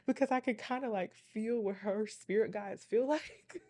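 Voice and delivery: amused, emphatic, laugh bubbling up. Real, not acted. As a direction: let the laugh break into the words. Laughing as she explains.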